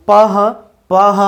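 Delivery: "পাহ" with a distinct h after the p; p and h sounded separately in "পাহ"; p plus h. Shown as a demonstration. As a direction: say "পাহ" as p, then an audible h. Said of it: "প এর সঙ্গে একটি হ ধ্বনি".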